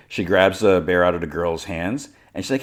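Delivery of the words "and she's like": ordinary delivery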